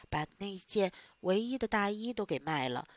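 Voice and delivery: usual speaking voice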